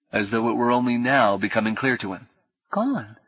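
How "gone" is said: stunned. A narrator's reading. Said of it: "a kind of astonishment".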